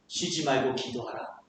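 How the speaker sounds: low, soft, gentle invitation; with God's heart. Acted. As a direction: say it tenderly as God would. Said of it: "하나님의 마음으로 보세요".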